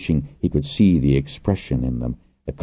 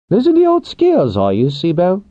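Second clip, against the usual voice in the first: teasing character voice; 5 dB louder than usual. A narrator's reading. Pinch teasing.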